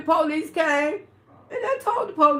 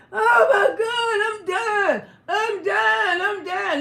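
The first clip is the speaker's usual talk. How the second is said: high, loud crying wail; hammed-up sobbing cries for help; mock wailing victim; 5 semitones higher, 5 dB louder than usual. [name] imitating the bleeding woman wailing.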